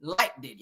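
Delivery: "liked it" said as like-did; mocking Wanda's pronunciation. Quoting Wanda to the viewers, "liked it" as like-did. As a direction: hit both d's in "liked it". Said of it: "wanna be saying liked it".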